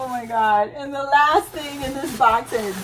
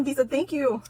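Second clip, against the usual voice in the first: usual speaking voice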